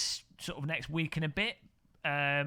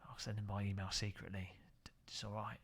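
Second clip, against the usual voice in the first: hushed, conspiratorial whisper; secretive whisper; 6 semitones lower, 12 dB quieter than usual. Pretend. Streamer confides a secret email deal, whispering.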